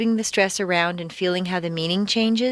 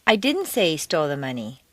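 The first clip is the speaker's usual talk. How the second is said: "didn't" raised and emphasized; stress on DIDN'T; 3 semitones lower than usual. Shown as a demonstration. Teacher denying having said it, stress on "didn't". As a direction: punch "didn't" in firm denial. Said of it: "indicate that you're denying having said".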